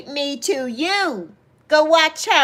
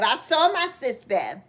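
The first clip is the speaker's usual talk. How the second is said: usual speaking voice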